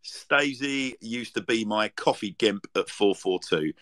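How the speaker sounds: ordinary talk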